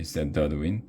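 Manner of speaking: usual speaking voice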